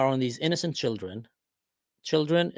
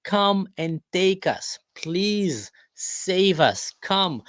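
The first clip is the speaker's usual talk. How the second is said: high, slow, drawn-out begging plea; pleading minorities; 6 semitones higher, much slower than usual. Acted. Minorities begging Israel to save them, high slow pleading. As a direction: beg to be rescued, stretching every word. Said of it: "coming and saying".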